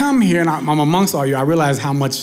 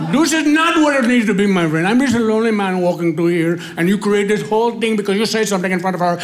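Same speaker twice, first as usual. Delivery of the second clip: upset Indian man, higher and singsong; Indian accent; 4 semitones higher than usual. Retold or imitated. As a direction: scold in a fussy Indian accent. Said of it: "Indian person cuss".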